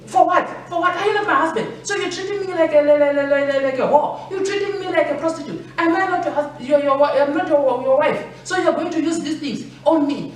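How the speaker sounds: high, offended wife, near-shouting in disbelief; hurt, outraged wife protesting to her husband; indignant wife. Acted. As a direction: play the insulted wife, up in her voice: how dare you.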